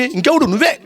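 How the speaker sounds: high, quick retort; acted accusation. Queen tells the king he signed it, high accusing retort. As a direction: throw the blame straight back at him.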